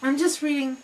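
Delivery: high, rushed no no no; flustered denial. Retold or imitated. Explaining to the bus driver, a high flustered denial. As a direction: protest to the driver, a little panicked. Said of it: "And I was like".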